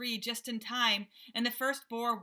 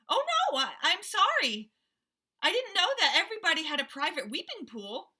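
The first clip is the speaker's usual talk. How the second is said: high, loud panicked sorry; frightened apology; 8 semitones higher, 6 dB louder than usual. Acted. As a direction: squeal while dangling in fear. Father caught, apologizing.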